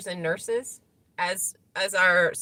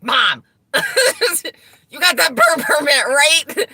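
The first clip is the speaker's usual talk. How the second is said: son's voice; 8 semitones higher, 6 dB louder than usual. Retold or imitated. Son questioning mom about permit.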